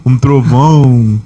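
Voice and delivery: slow booming "trovão"; slowed-down mock divine rumble; mock thunder voice. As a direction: drag out "Um trovão" like a voice from beyond. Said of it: "com uma voz grossa".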